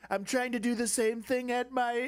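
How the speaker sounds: high, breathy awestruck Trump voice; Trump impression. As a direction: gush like a starstruck Trump. Impersonating Trump praising palace.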